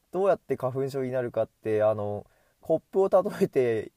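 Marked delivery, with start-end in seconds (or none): none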